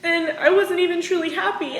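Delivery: ordinary delivery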